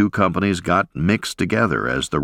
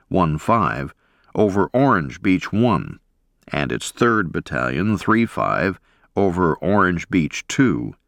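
plain reading voice

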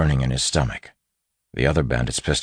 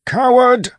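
very high, loud single cry; furious yell; 10 dB louder than usual. A narrator's reading. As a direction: scream contempt at the leader. Old man yells at bandit leader.

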